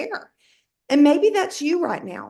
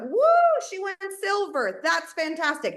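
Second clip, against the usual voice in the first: mock celebration; 6 semitones higher than usual. Cheering herself for going silver, high and light. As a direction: play a pretend crowd cheering, pitched up but held back. Acted cheer for own small win.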